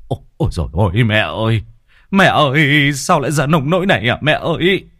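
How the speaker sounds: rising anguished lament, hurried in the middle; wailing for mother. A narrator's reading. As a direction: cry out in shock over his dead mother. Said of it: "tiếng la hét của chồng".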